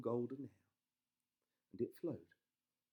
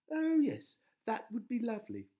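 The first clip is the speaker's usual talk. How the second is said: high, loud delighted wife; 13 semitones higher, 10 dB louder than usual. A narrator's reading. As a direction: gush it high. Wife voiced in story.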